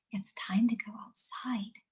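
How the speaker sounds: slow, soft unvoiced whisper; gentle whisper. Shown as a demonstration. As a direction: hush it kindly to a calming child. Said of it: "I'm really empathetic".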